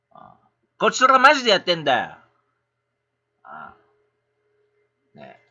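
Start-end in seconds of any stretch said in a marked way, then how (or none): 0.8-2.0 louder and fast, hitting マジ hard; blunt insistent outburst; forceful insistence. Real, not acted. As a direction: drive the point home angrily. Repeats it, now forcefully.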